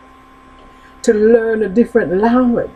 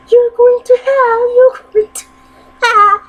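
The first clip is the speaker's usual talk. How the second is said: high squawking parrot, much louder; 6 dB louder than usual. Acted.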